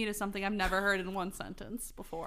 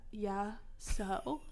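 put-on sad goth girl; 7 dB quieter than usual. Sad goth girl answering her friend, downcast. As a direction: keep it quietly sad. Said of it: "I'm gonna keep her sad".

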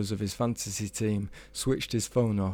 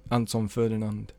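ordinary delivery, nothing marked

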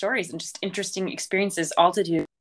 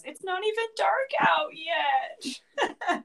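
high, slow crying whine; imitating a kid sobbing mid-complaint; crying child; 10 semitones higher, much slower than usual. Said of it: "Nora started crying and goes".

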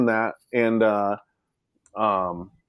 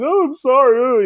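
much higher, louder hammed-up whimper; mock sheepish apology; 7 dB louder than usual. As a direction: play the caught crybaby apologizing. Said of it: "I'm like".

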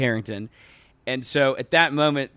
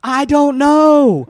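high, loud, stretched-out cluelessness; retold bewildered yell; 12 semitones higher, 9 dB louder, much slower than usual. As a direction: yell it back slowly, acting totally baffled. Bewildered answer to friends, retold.

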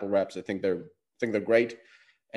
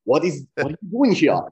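mock German accent, a touch higher and louder; jokey German-accented "what are you doing here"; 5 semitones higher, 5 dB louder than usual. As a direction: play the stern German doorman, in a German accent. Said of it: "more interesting in a German accent".

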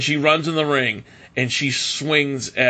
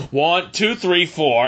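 mock fast ref count; 6 semitones higher, slower than usual. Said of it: "the ref went".

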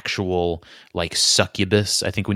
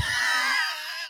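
a high burst of laughter at himself; shrieking laugh; 8 dB quieter than usual. Real, not acted. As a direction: break into a squealing laugh. Laughing at own self-description.

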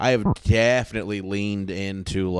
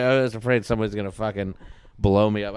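ordinary delivery

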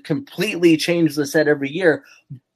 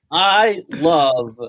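ordinary delivery

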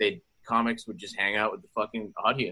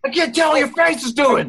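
raised pitch, half-shouted; loud high mock quote; 8 dB louder than usual.

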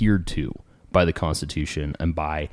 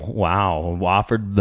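normal talking voice

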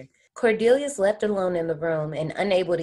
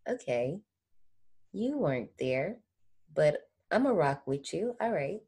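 quiet imagined reply; 8 dB quieter than usual. Retold or imitated. Imagined reply to someone who wasn't there, quiet and accepting.

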